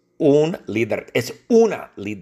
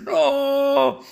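much higher, wailing mock Trump; Trump crying out; slower than usual. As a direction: squeal like a panicked Trump begging. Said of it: "Donald Trump grita". (Trump grita agudo y lloriquea por su cuchara)

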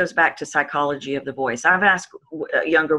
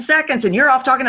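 usual speaking voice

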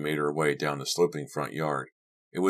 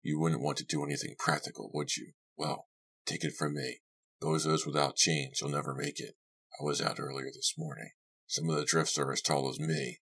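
quieter man's voice, talking down to someone; gruff-tempered host, dismissive; 6 dB quieter than usual. Acted. Host warning the visitors about the drifts, quietly dismissive. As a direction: softly dismiss them as the host. Narrator voices older man.

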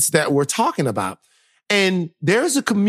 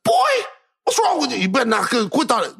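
high, scolding dad; mock-scold the crying boy; imitating dad; 7 semitones higher than usual. Retelling his dad's scolding, mock scolding. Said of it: "He was like".

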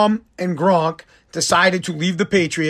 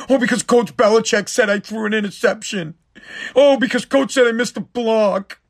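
exaggerated whine building into fake sobbing; mock whining. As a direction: mimic crybabies, pitch climbing as the complaints pile up. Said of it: "You guys sound like a bunch of fucking bitches".

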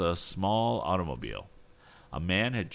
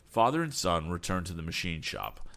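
plain reading voice

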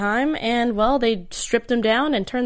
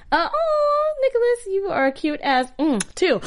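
mock surprise; 10 semitones higher, slower than usual. Real, not acted. Teasing co-host with mock surprise.

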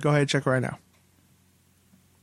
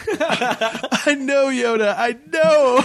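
high, exasperated whine; put-on higher, petulant voice; whiny acted Luke; 7 semitones higher, much slower than usual. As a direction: whine it like a sulky pupil. Acts whiny Luke answering Yoda.

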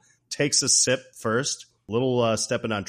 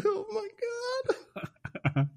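very high mock-overwhelmed squeak; stunned aside; 5 dB quieter than usual. Jack seeing Joan in lingerie, high flustered squeak. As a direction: squeak it out, imitating a flustered man. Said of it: "He's like".

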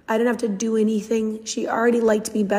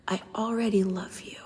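a soft, voiceless whisper; whispered confession; 5 dB quieter than usual. Retold or imitated. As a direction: breathe it like a shy child's secret. Child confides a secret.

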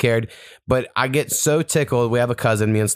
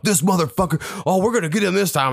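mock gamer rage; 8 semitones higher than usual. Player raging at a camper.

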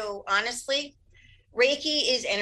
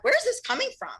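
surprised; 13 semitones higher, faster than usual. Retold or imitated. Incredulous retold reaction.

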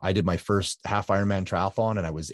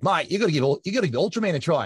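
imitated Australian guy, pitched up; Australian accent; 9 semitones higher, 4 dB louder, faster than usual. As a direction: put on a high Australian accent. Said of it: "he's a really great Australian guy".

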